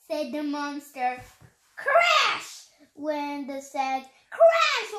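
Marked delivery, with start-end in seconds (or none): 1.7-2.6 high, loud burst; exclaimed crash. A narrator's reading. Reader voicing the monster's fall, loud exclamation. Crash sound word in the story. 4.3-4.9 very high, loud burst; exclaimed crash. A narrator's reading. Reader voicing the monster's fall, loud exclamation. Crash sound word, read a second time.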